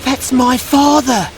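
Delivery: high, loud shriek; shrieking puppet boy. Acted. Pinocchio shrieks seeing his father's boat in peril. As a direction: cry it out in panic. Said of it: "shrieked Pinocchio".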